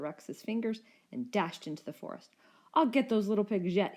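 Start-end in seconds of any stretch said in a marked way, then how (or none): 2.7-3.9 growled. A narrator's reading. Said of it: "he growled".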